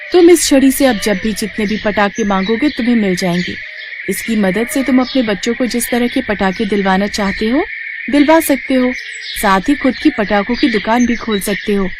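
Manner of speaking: a freed fairy thanking her rescuer; grateful fairy. Acted. Fairy grants wand.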